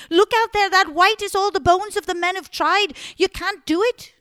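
high, loud frantic pleading; desperate begging. Acted. Margaret begging Simon not to fight, high and loud. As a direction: beg him in a panic, voice high. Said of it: "she started begging".

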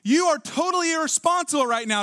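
high-pitched mock scolding; acted accusation, pitched well up; scolding wife. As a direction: lay into him like an exasperated wife. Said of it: "when she says something like".

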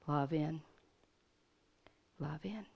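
quiet prayer. Retold or imitated. Preacher praying quietly over the seizing girl, soft. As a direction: speak it softly over the girl. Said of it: "I didn't need to scream".

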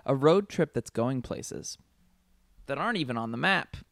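slow tagline read with a dramatic pause after "places"; drawn-out "going places", a beat, then the payoff; pause for ellipsis. Acted. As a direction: hang on "places", pause, then finish the line. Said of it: "That's why I paused".

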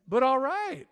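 ordinary talk

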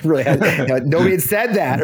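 real laugh breaking into the line. Real, not acted. Recalling the Baghdad talk, laughing.

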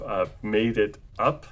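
ordinary delivery

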